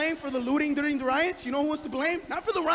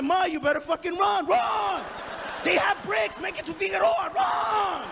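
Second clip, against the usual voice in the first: yelling at mom; 5 semitones higher than usual. Retold or imitated. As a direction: scream at Mom to run for her life. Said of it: "I'd have been like".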